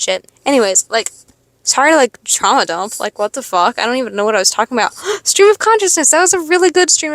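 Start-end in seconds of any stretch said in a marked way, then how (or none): 4.9-7.1 delighted gasp. Real, not acted. Delighted realization of own ramble.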